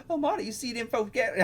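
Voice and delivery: high, fast country voice, amused; failed feminine country accent. Acted. Retrying the preppy kid's line, shaky attempt at feminine country accent. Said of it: "feminine voice with a country accent".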